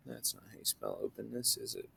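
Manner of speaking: very soft, under the breath; quiet self-correction, barely voiced; muttered aside. Real, not acted. Teacher to self over a misspelt word, muttered aside.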